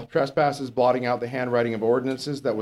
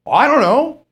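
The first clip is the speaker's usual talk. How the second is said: mock panicked believer; 9 semitones higher, 9 dB louder, slower than usual. Deathbed believer quoted, unsure.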